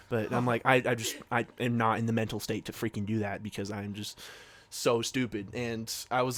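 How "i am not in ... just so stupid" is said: ordinary talk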